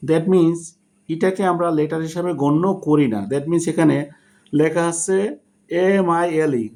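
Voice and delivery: ordinary talk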